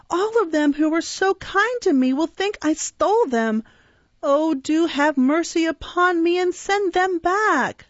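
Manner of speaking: passionate grief. A narrator's reading. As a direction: beg them desperately, close to tears. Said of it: "uttered with all the energy of passionate grief".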